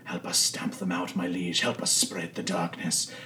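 hushed, solemn invocation; quiet ritual voice of a cultist; dark cult leader. Acted. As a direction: intone a soft prayer to a dark lord. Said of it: "this cult leader in, like, dark robes saying".

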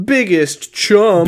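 hammed-up yearbook award voice; slow mock award announcer. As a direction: drag out the superlative.

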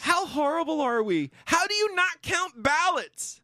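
high-pitched incredulous rant; worked-up, high-flung outrage; outraged. Real, not acted. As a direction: exclaim it high in disbelief. Outraged at the ballot recount.

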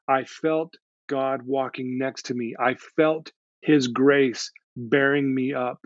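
slow, measured, with spaced phrases; reverent testimony. Retold or imitated. Client tells counselor of feeling God near, slow and reverent. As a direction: let each phrase settle, imitating her awe.

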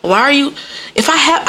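ordinary delivery

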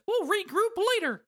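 very high squeaky falsetto; Mickey Mouse voice. Acted. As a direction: chirp it like Mickey. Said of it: "Mickey Mouse says".

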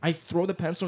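normal talking voice

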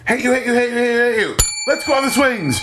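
childish mock voice. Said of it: "it's like".

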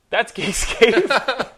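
very slow, loud, high announcer call; drawn-out sign-off. Real, not acted. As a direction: stretch it out like a show host. Show sign-off.

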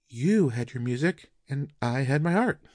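matter-of-fact reply from the wife; wife answering her husband's sudden question; wife's answer. Acted.

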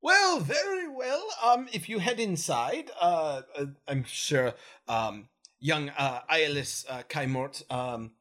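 a formal lady soldier, pitched high; female Gith voice. Acted. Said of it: "This is my female Gith voice".

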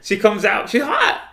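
loud, rising excitement; excited reveal. Real, not acted. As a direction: hit the surprise twist high and loud. Excited reveal to doubting listener.

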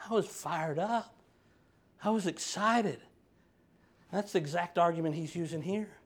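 usual speaking voice

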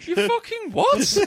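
slow, high, incredulous Scottish growl; mock Scottish outrage. Friend imitating the hero's baffled reply, slow and high. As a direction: stretch out a disbelieving Scottish retort. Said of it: "he's just like, going".

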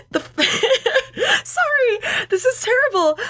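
high, squeaky laughing speech; helpless laughter. Real, not acted. Host apologises to co-host before a joke, helplessly laughing. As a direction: apologise through a fit of laughter. Breaks up laughing at own accent.

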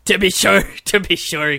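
loud-starting stage Irish lilt; mock Irish accent. Friend claiming to be Irish, in mock Irish accent. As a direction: ham up a stock Irish accent. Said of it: "'cause I'm Irish".

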